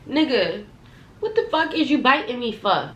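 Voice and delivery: retold mad reaction. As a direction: act out indignant disbelief at being bitten. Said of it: "I got mad".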